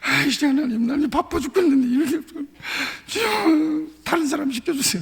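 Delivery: high, tearful whining plea to God; hammed-up weeping complaint, voice breaking on 주여; crying prayer. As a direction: beg through mock sobs to be let off. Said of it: "막 울면서".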